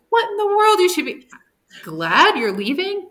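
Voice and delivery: incredulous disciples. Retold or imitated. They're like.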